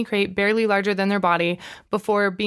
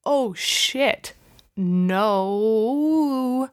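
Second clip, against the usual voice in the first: shocked realization; much slower than usual. Retold or imitated. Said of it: "I was like".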